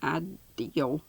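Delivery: "ideal" split as eye-DEEL; odd pronunciation. Shown as a demonstration. Reader shows how Jack pronounced it, "ideal" as eye-DEEL. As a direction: stretch the end of "ideal" into a long DEEL. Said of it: "he pronounced it I".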